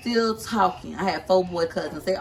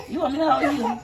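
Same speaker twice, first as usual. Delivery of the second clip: higher, teasing; mock flirty; 7 semitones higher than usual. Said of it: "Samantha had said".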